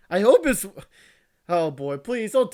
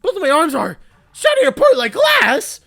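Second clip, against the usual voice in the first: shouting in pain, voice raised high; 7 semitones higher, 6 dB louder than usual. Acted. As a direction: cry it out like arms are breaking. Reading pained game scene aloud.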